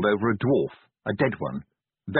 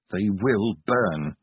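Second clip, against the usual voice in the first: slow, eerie distant voice; a cold threat drawn out slowly; menacing voice; 3 semitones lower, much slower than usual. A narrator's reading. Voice in Vimes's mind.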